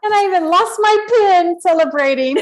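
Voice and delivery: excited laughing. Real, not acted. Consultant joking about celebrating her finished contract, giddy.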